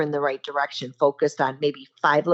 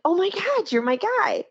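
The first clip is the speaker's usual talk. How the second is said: excited; 13 semitones higher than usual. Retold or imitated. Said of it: "And I'm like".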